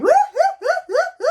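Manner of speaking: loud high whooping laugh; high hooting guffaw; hee-haw laugh. Shown as a demonstration. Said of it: "that's when you really got to go".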